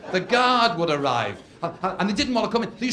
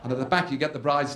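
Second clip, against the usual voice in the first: ordinary delivery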